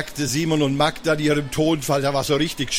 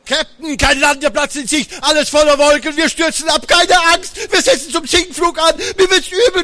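excited kids playing; 11 semitones higher, 5 dB louder than usual. Kids playing a plane crash.

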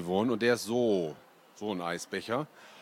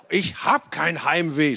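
louder, higher, slow protest; shouting; 5 semitones higher, 5 dB louder than usual. A narrator's reading. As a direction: yell it to stop the teasing. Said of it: "schrie ich". (der Junge schreit trotzig, lauter und langsam)

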